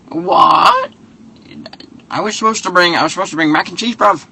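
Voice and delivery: put-on squeaky high London accent, baffled; mock London accent. Son-in-law surprised about the mac and cheese, high mock London accent. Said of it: "he's just saying bruv too much".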